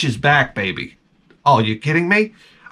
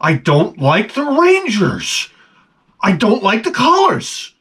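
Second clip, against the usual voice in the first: slow, higher put-on voice of Tom; imitating Tom; 6 semitones higher, slower than usual. Mimicking the coworker's sulky reply, slow and higher. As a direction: drag it out in a dopey mimic. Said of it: "And he would say to me".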